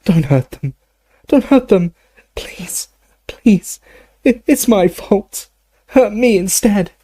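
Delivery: high, anguished begging; moaning plea. A narrator's reading. Dumbledore begging to be hurt instead, high moaning plea. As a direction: moan and beg in a high voice. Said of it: "as he moaned".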